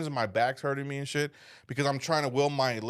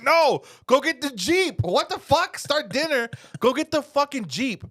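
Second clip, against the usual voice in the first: loud, high, incredulous orders; exasperated shouting; 12 semitones higher, 5 dB louder than usual. Retold or imitated. Cramped rider ordering girlfriend to fetch the Jeep, exasperated shout.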